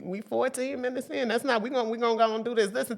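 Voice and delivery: ordinary talk, nothing marked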